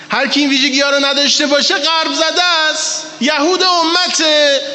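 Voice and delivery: fiery preacher's shout. Real, not acted. Preacher denouncing the Westernized before the crowd, shouting. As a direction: hurl it at the crowd. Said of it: "خیلی حرفم تند شد".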